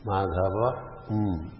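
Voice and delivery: MADHAVA, then a separate hummed M; final M detached. Shown as a demonstration. Teacher showing the uncounted M, Madhava with M set apart. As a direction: split the closing M off Madhava. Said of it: "M is not taken as a syllable".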